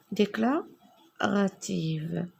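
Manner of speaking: usual speaking voice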